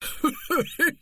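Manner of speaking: high forced chuckle; a knowing, jocular laugh; hammed-up sly laugh; arch laugh. A narrator's reading. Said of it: "laughed once more with arch jocularity".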